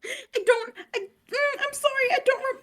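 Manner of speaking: very high, tearful, broken pleading; hysterical crying. Acted. Liv sobbing to the soldier, hysterical and confused. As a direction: sob it out, lost and terrified. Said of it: "full hysterics".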